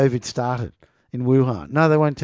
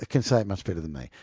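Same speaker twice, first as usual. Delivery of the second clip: ordinary talk, nothing marked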